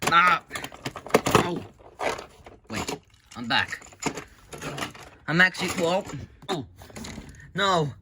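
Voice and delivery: loud high yelps of pain; mock pained screams. Tortured by the spirit, loud high screams.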